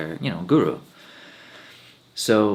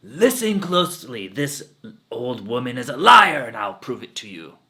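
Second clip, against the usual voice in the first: loud, high call to the crowd; loud addressing of the village; public proclamation; 3 semitones higher, 9 dB louder than usual. Acted. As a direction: command everyone's attention. Guru addresses whole crowd.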